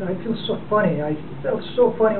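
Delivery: normal talking voice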